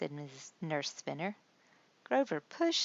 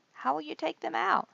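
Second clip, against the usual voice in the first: put-on worried child voice; 12 semitones higher, 5 dB louder than usual. A narrator's reading. Worried Grover asks.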